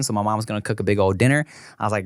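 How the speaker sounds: ordinary talk, nothing marked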